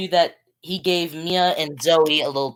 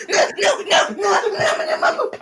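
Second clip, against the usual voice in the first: high, laughing panicked no's; mock panic. Player retelling his scare from the mold monster, mock panic. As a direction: replay your panic in a high, breaking-up squeal.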